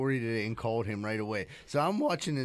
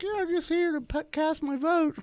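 put-on innocent old voter; 13 semitones higher than usual. Voicing the bewigged old voter.

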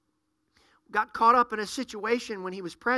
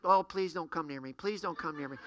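muttered inner dread; 7 semitones lower, 8 dB quieter than usual. Retold or imitated. Inner dread at seeing someone.

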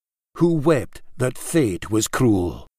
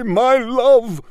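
much higher and louder; anguished cry; 12 semitones higher, 6 dB louder than usual. A narrator's reading. Laird crying farewell, loud and high. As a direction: cry it out so all can hear. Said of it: "heaving one last anguished cry".

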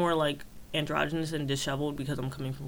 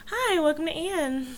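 much higher, slow, sugary welcome; customer service voice; 12 semitones higher than usual. Retold or imitated. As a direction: put on the cashier greeting. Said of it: "use my regular voice, like, this voice at work".

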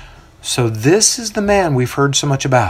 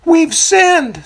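lamenting; 4 dB louder than usual. A narrator's reading. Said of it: "some from the crowd lament".

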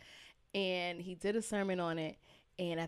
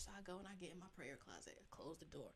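whisper; 20 dB quieter, faster than usual. Retold or imitated. Going into prayer closet.

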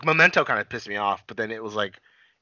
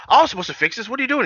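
high, loud indignant protest; indignant complaint; 11 semitones higher, 5 dB louder, faster than usual. Retold or imitated. O'Brien finds someone fixing his job, indignant and loud. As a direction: protest with rising outrage. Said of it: "And he's like".

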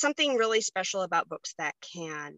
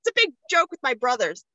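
ordinary talk, nothing marked

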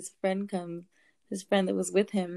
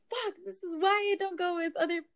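high, laughing-crying lament; half sob, half laugh; relieved laugh-cry at the car; 8 semitones higher than usual. Retold or imitated. Hugging her car in relief.

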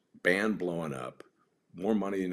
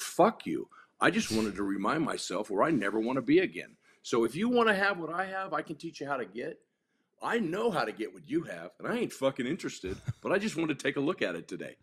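blunt raised voice; 8 semitones higher than usual. Retold or imitated. Confronting the kid again.

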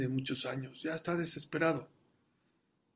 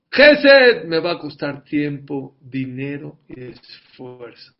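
slow, loud, mocking the doubter; a doubter's grumble, hammed up; mock doubter's thought; 15 dB louder, much slower than usual. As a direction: spell out the excuse slowly. Preacher voices a doubter's thought.

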